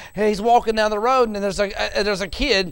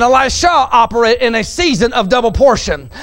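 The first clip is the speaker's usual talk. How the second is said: loud, higher, building preacher's cry; fiery preaching; 5 semitones higher, 6 dB louder than usual. Acted. Preacher to congregation, loud fiery sermon climax. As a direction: preach it out to the congregation at full force.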